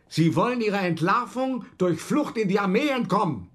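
corner the painter with a sharp, angry accusation. Acted. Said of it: "Da bin ich zum ersten Mal richtig scharf geworden". (scharfe, wütende Anklage, höher und lauter)

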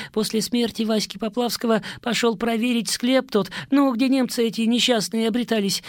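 high, feverish rambling prisoner; rambling addict. Acted. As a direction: confess in a frantic babble.

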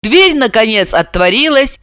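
plain reading voice

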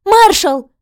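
high anguished cry; desperate scream. Acted. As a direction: cry out his name. Heroine cries out to him.

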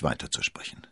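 ordinary delivery

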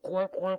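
high loud mocking quacks; duck quacking. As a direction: cackle like silly ducks. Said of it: "les canards de cancaner".

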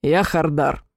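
низкое демоническое рычание; прорычать как зверь; рычащий демон. A narrator's reading. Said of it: "и прорычал". (демонический рык)

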